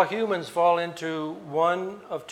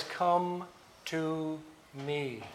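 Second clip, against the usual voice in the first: "Come to me" drawn out very slowly and softly, with weight on "come"; slow, deliberate call with the stress on "come"; a quiet, earnest summons; emphatic invitation; 6 dB quieter, much slower than usual. Shown as a demonstration. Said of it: "The same emphatic expression".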